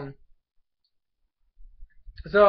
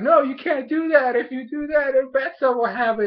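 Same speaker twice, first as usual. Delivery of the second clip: higher, louder mock old man protest; panicked old man; 8 semitones higher, 3 dB louder than usual. Vlogger retelling the old man's warning, high mock alarm. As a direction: play the old man crying out no, in a hammed-up way. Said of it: "And the old man's like".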